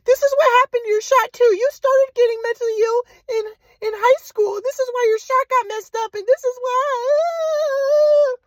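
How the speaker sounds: high caricature of a lamenting mother; mom impression. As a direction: wail it like a mother blaming the past. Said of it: "And mom said".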